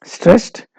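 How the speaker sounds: stressed jumped up high in pitch; stressed sung out high as a stress demo; stressed word said with stress. Shown as a demonstration. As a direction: lift and hit stressed to show it. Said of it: "first comes the".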